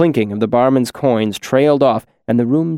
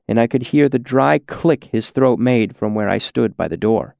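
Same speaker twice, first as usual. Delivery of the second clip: usual speaking voice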